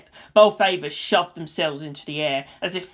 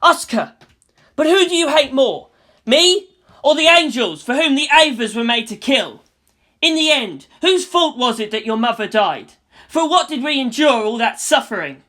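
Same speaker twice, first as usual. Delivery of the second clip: put-on louder, raised boy's voice pleading; urgent calling out; 7 semitones higher, 7 dB louder than usual. A narrator's reading. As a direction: shout through to her.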